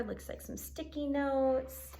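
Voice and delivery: normal talking voice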